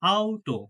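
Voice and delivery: German auto, opening on ow; correct German pronunciation. Shown as a demonstration. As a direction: say auto with a clear "ow" diphthong. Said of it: "এটা কিন্তু অটো না".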